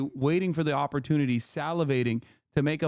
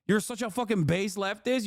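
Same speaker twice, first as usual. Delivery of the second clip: ordinary talk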